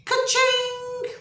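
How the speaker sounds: very high-pitched ringing exclamation; cash-register cry. Acted. Wife eyeing the husband's money, high-pitched ka-ching. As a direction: ring it out like a till.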